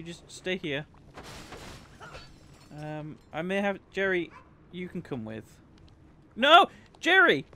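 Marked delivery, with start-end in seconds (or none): 6.3-7.4 loud, high cry after a pet; alarmed shout. Real, not acted. Streamer shouting after his creature Jerry, alarmed yell. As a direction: yell out to save your little friend.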